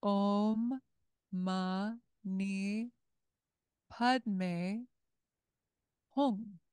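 Om Mani Padme Hum syllable by syllable with pauses; mantra sounded out. Shown as a demonstration. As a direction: read Om Mani Padme Hum slowly, word by word. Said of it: "from the beginning".

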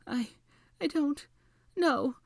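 high, halting, tearful answer; miserable. A narrator's reading. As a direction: falter miserably between the words. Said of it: "Hermione sniffed miserably".

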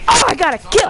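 high loud triumphant cry; thrilled victory shout; excited shout. Real, not acted. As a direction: yell the win out. Gloating over a kill.

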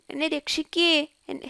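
normal talking voice

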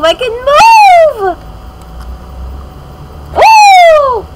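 high, loud, stretched cry of joy; excited shriek. Real, not acted. Thrilled she can finally move, high loud cry. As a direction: shriek with delight.

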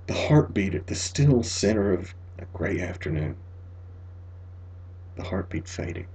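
fading to a whisper. A narrator's reading. Narrator whispering the heartbeat fading away. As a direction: let the heartbeat die away in a breath.

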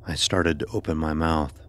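ordinary delivery, nothing marked

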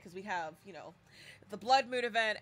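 ordinary talk

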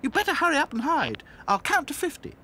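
high bright doll voice; cheerful little doll; Dotty voice. A narrator's reading.

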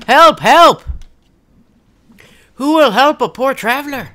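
loud, high hammed-up call for help; mock cry for help. Poor traveler calling for help in the play, hammed-up shout. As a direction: shout for help like a stagey victim.

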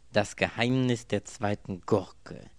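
langsam, feierlich betont; Titel verkündet. A narrator's reading. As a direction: wie einen Buchtitel ankündigen. Anthony tippt Romantitel. (feierlich verkündeter Titel)

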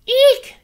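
piercing high, very loud shriek; shrieked eek. Acted. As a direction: shriek in shock at the sight. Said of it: "she cried".

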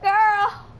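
high, loud exclaim; mock diva shriek; sassy shout. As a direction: fling out a sassy yell. Sudden outburst.

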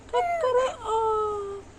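high, slow mock rooster crow. Mother crowing like a rooster for her baby, high and slow.

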